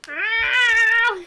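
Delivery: loud sudden yelp; startled shout. Real, not acted. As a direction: let out a sharp startled cry. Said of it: "That was me that time".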